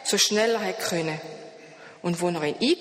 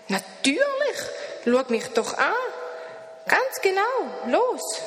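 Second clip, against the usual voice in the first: much higher, bright little creature voice; high creature greeting; 12 semitones higher than usual. A narrator's reading. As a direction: greet him like a cheerful odd creature. Das Etwas lacht. (hohe Stimme des Wesens)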